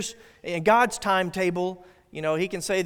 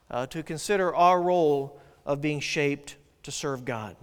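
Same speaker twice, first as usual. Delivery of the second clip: usual speaking voice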